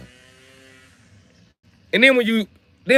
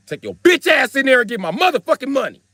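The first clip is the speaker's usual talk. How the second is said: raised, high-pitched aggressive command; angry order; 8 semitones higher, 3 dB louder than usual. Retold or imitated. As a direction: order him inside, voice pushed up. Grabs the man by the collar.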